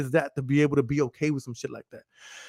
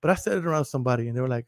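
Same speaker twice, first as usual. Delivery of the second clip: normal talking voice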